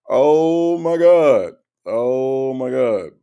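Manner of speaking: long, slow exasperated groan of words; drawn-out disbelief after laughing; drawn-out exclamation. Real, not acted. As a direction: stretch it out slowly, first loud then easing. Recovering from a laughing fit.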